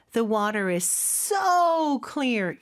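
"so" stretched long for emphasis; slow teaching demo with a long, stressed "so". Shown as a demonstration. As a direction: lean hard on "so", drawing out the vowel. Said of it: "강조하고 싶으면".

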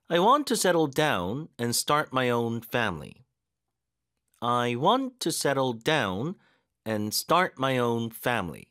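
deliberate drill with strong stress on want; stressed practice reading. Shown as a demonstration. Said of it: "이렇게 힘줄 곳 다 느껴지셨을 거고".

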